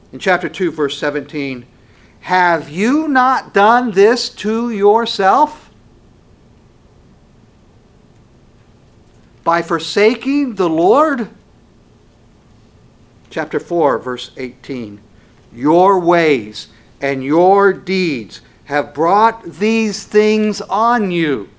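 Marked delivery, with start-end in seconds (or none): 2.2-11.3 slow, high and loud preaching; preacher hammering the verse; forceful scripture rebuke. Acted. 15.5-21.5 very slow, loud and high; slow thundering verdict. Acted. Preacher reads scripture rebuke.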